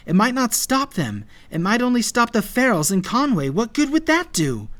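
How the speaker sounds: high-pitched, pressing argument; pleading reasoning. A narrator's reading. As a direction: reason urgently with a friend. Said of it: "Maddie reasoned".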